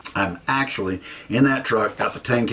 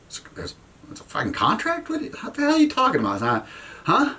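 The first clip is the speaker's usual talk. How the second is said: incredulous; 13 semitones higher than usual. Retold or imitated. Reacting to the contract excuse.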